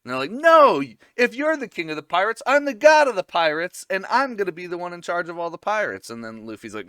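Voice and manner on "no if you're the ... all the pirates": loud, high, fast cartoon bragging; mock boastful shout. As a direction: yell it like a pompous pirate clown, then settle a bit. Buggy boasting, shouting at Luffy.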